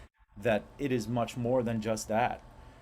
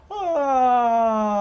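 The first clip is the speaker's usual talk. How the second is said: loud sigh sliding from high notes down; demo sigh from high to low; 11 semitones higher, 11 dB louder than usual. Shown as a demonstration. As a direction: sigh from the top note down to the bottom. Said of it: "the notes from C all the way back down to C".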